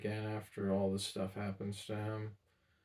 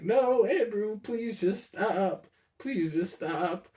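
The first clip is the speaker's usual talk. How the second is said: higher, slow, tired pleading refusal; a tired old man begging to be left alone; weary grandpa; 10 semitones higher, 6 dB louder, much slower than usual. Retold or imitated. Grandpa telling Andrew to stop pushing, slow and weary.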